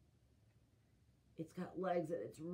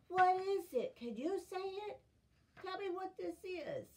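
eager questions; 10 semitones higher, 4 dB louder than usual. Acted. Pleading with kids.

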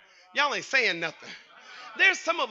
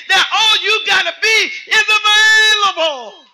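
full-force preaching; 8 semitones higher, 7 dB louder than usual. Real, not acted. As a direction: build to a long, loud cry for the congregation.

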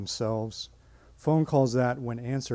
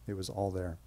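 ordinary talk, nothing marked

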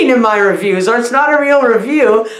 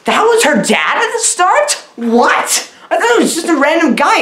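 high, incredulous audience reaction; hammed-up disbelief, pitched well up; mock shock; 6 semitones higher than usual. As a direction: play an audience floored by the twist.

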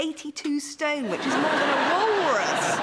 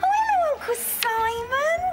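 much higher, louder gushing "Oh, hello"; delighted greeting; 12 semitones higher, 3 dB louder than usual. Acted. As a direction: sweep up in delight at the caller. Alice answers the phone brightly.

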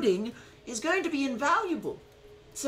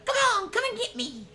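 taunting hero; 10 semitones higher than usual. Retold or imitated. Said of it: "doing the".

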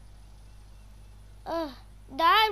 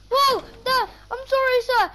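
startled apology; 6 semitones higher, 3 dB louder than usual. Pretend. Caught trolling, apologizes.

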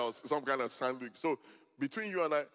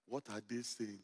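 imitating the mentor; 5 semitones lower, 9 dB quieter than usual. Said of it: "That's the way he talked".

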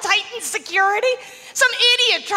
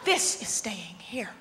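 low, much softer, slow; mock stern guard; 11 semitones lower, 10 dB quieter, much slower than usual. Comic plays guard confiscating a safety pin, slow and low. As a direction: lay down the rule slowly and quietly.

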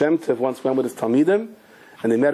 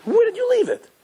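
shrill panicked squeal, much higher; much higher, hammed-up panic; frantic high voice. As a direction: cry it out high in alarm. Frantic search for money.

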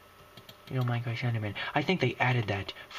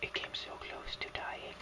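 much softer, hushed; whispered; 11 dB quieter than usual. Real, not acted. To viewers, everyone asleep nearby, whispered. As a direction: barely breathe it out. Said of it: "whisper yell because everyone's sleep".

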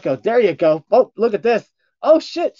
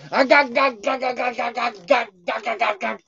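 sound; 9 semitones higher than usual. Pretend. Silly repeated noise.

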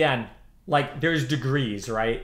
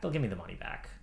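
softer, lower repeat to himself; quieter repeat; 8 semitones lower, 10 dB quieter, faster than usual. Real, not acted. Reassuring himself the refund will come, softer. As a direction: murmur it again to reassure yourself.

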